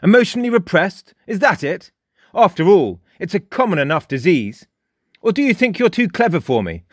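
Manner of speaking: put-on high, loud, cocky boy's voice; mocking Frost. A narrator's reading. Frost lectures Jake, high and loud, mocking. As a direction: needle him with a sneer.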